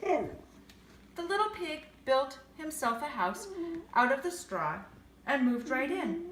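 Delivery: ordinary delivery, nothing marked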